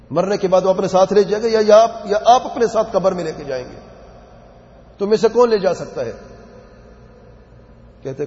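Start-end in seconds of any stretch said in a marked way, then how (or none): 0.1-6.1 forceful preaching. Acted. Preacher's rhetorical climax.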